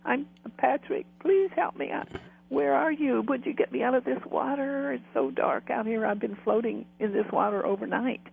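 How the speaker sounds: high, plaintive voice of a stranded man; imitated pleading. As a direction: act out begging to be rescued, easing gradually. Voices Patrick stranded in dark water.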